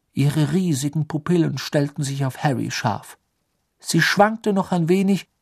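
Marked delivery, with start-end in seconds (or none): none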